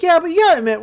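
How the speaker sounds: high, loud, excited girl imitation; upbeat Mabel imitation. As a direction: chirp it with acted enthusiasm. Mabel cheers up the group.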